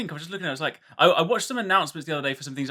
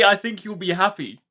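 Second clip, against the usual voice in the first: higher, louder mocking echo; mocking quote; 4 semitones higher, 4 dB louder than usual. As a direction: throw his words back sarcastically. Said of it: "how can you say".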